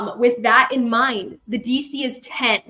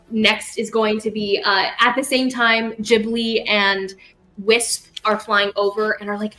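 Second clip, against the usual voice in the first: normal talking voice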